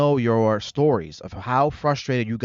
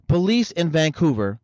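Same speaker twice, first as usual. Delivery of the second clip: usual speaking voice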